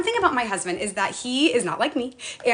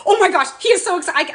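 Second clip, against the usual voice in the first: excited gush; 8 semitones higher, 6 dB louder than usual. Retold or imitated. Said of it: "I'm like".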